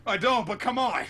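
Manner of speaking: high, loud mock exasperation; Law, upset. Law protests to Straw Hat about the pact, upset mock whine. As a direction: whine loudly in put-on protest. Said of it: "Law is kind of upset about it".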